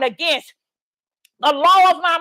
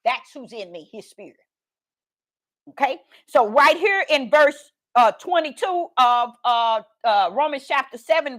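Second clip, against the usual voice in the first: normal talking voice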